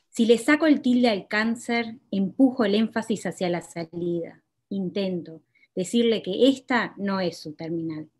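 measured, deliberate poetry reading; poem reading. Acted. Poet reading her own poem to the audience, measured.

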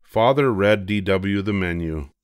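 ordinary delivery, nothing marked

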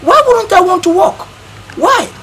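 high-pitched, insistent exclamation; emphatic protest. Acted. Man suddenly eager for the job, emphatic protest. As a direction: protest it as if the idea were absurd. Eagerly reverses his earlier refusal.

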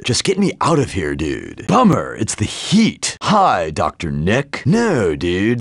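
normal talking voice

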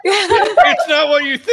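high, laughing, slowed mock plea; laughing Wally line. Fan laughing while quoting Wally's commercial line. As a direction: giggle through it, drawn out.

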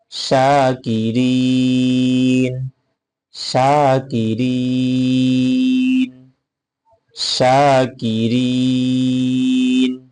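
শাকিরিন drawn out slowly, each syllable clear; শাকিরিন said slowly with a long i; slow model reading. Shown as a demonstration. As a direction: read শাকিরিন slowly like a Quran teacher. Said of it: "teacher models the word reading".

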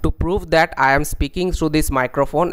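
ordinary talk, nothing marked

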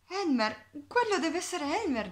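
delighted realisation; excited recognition. Acted. Elephants recognising Elmer, excited cry.

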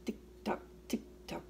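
soft ticking; clock ticking. Pretend. Reading a picture book, softly ticking like a clock. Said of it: "He can sound like a clock".

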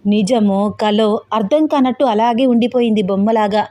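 usual speaking voice

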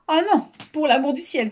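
high, pleading cry of protest; dismayed exclamation. A narrator's reading. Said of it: "s'écria madame Bartolotti".

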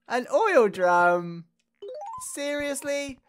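loud, drawn-out disbelief on the item; incredulous. Real, not acted. Player gets an oil drum in a trade, incredulous. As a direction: announce it, then question it slowly.

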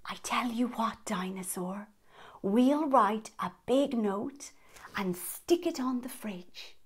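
hushed and gentle, slowed down; soft whisper. Acted. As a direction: whisper it to calm a scared friend. Hushed comfort to Dinosaur.

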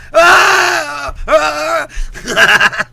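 long, high, loud wail; mock movie scream. Mimicking the Revenant's hero crawling along, mock scream. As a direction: scream like the suffering film hero. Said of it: "crawl and scream the whole fucking movie".

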